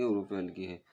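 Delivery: normal talking voice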